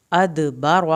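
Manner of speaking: ادبر with a bouncing dal, loud; qalqala on dal. Shown as a demonstration. Teacher shows the echoing dal, loud. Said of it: "دال کا قلقلہ کریں گے".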